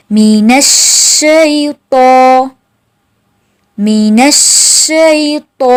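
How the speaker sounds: मीनश्शैतो slowly with a heavy long तो; slow drilled recitation. Shown as a demonstration. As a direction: hold the heavy तो at the end. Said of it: "वो भी मोटा होगा". (मीनश्शैतो धीरे, भारी लंबा तो)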